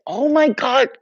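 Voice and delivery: high loud oh my God; surprised outburst. Real, not acted. Speaker suddenly realises the word, loud surprise. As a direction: burst out in real surprise.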